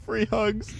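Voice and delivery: squealing through laughter; laughing too hard. Real, not acted. Healing a teammate but laughing too hard. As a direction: choke the words out mid-laugh. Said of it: "trying to utter out free hugs".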